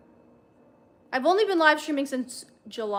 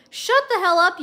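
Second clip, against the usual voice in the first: inner scream; 6 semitones higher, 7 dB louder than usual. A narrator's reading. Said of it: "was screaming".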